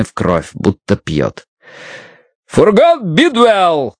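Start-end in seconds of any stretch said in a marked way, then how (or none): 2.5-3.9 high, slow, drawn-out demand; demanding shout. A narrator's reading. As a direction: hammer out the demand. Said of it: "потребовал Джонстон".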